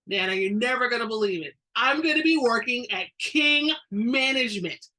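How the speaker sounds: can't hold the good news in any longer; excited exclaim. A narrator's reading. Grandson bursting with news to his Nana, excited. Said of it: "Evan exclaimed, unable to contain his excitement".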